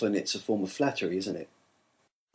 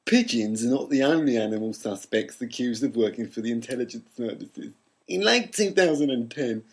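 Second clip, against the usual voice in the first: cool foreign spy menace; 5 semitones higher than usual. Acted. Read clip in put-on voice.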